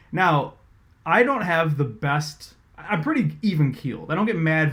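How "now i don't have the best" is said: ordinary talk, nothing marked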